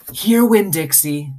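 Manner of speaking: put-on high, slow girl's call; calling a dog. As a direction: try out a new name on the dog. Girl names and calls the dog.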